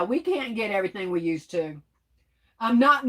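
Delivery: ordinary talk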